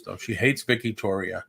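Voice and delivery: ordinary delivery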